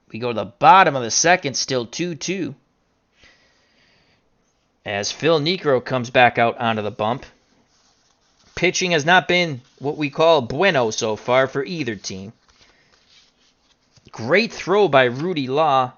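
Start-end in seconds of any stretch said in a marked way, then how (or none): none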